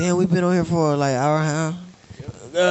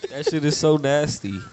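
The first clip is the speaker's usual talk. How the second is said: ordinary delivery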